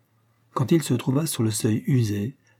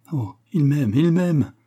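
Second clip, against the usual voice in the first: high, swelling cry of joy; elated cry; 5 semitones higher, 4 dB louder, slower than usual. A narrator's reading. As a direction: exclaim it, overwhelmed at being loved. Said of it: "s'écria Pauline". (cri de joie, voix qui monte)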